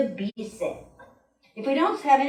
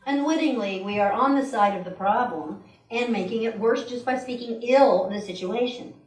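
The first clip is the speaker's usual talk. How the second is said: ordinary talk, nothing marked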